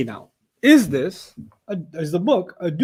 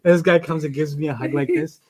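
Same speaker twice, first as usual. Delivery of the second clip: ordinary talk, nothing marked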